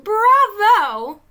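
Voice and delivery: high, very loud cheer. Acted. Chinchetta cheering the team. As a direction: shout praise while clapping. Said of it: "Chinchetta clapped".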